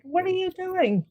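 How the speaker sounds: a husband catching his wife mid-ritual; alarmed husband. Retold or imitated. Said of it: "my husband's like".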